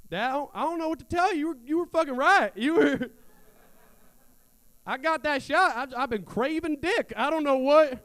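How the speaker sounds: put-on a Southern guy confessing to his dad in a high, loud Southern accent. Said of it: "Doing that voice, I realized we need a Southern guy".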